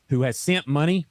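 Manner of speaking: normal talking voice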